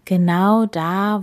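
plain reading voice

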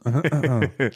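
throat-clearing cough; coughing fit. Real, not acted. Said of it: "wir räuspern uns alle mal kurz".

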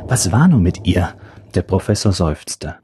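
plain reading voice

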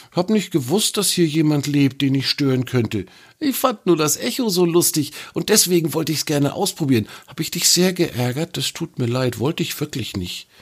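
a little troll saying sorry to the snake; lightly voiced apologetic troll. A narrator's reading.

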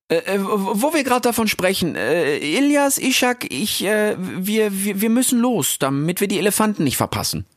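put-on high, halting young voice; timid Rix. (schüchterner Rix, hoch und stockend)

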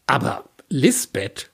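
slow, drawn-out objection; a hint of a hurt protest; reproachful but held back; lightly voiced protesting man. A narrator's reading. Imi objects to Lisbeth.

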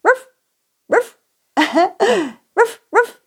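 high mock woof woof; playful barking. Narrator plays a happy dog with mock barks. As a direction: bark like a little happy dog.

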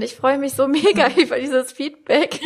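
laughing with delight while speaking; bubbly, breaking into laughter. Real, not acted. Delighted by guest's feedback.

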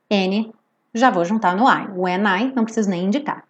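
usual speaking voice

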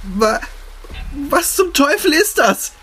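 terrified scream. Acted. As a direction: shriek it in sheer terror. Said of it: "schrie sie". (panischer, schriller Schrei voller Angst)

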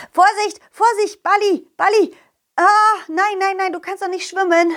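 high, loud frightened calls; alarmed shout. Acted. The dog shouts in fear as Balli falls into water. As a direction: cry out in panic for Balli.

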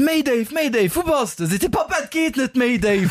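very high-pitched joke punchline; mock distress call. Comedian acting out Mayday's emergency call, high mock panic. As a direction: squeal out the frantic call in mock alarm.

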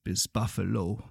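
solemn, measured ballad reading; poem recitation. Acted. Ballad refrain, slow.

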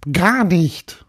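very high-pitched tiny ghost voice; little castle ghost. Acted. Little ghost tells Paul where it lives, high ghost voice. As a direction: squeak it out as a small, self-assured ghost.

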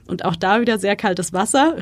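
ordinary talk, nothing marked

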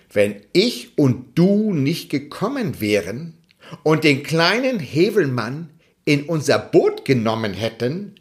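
plain reading voice

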